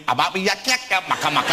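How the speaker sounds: mock gibberish. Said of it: "You went".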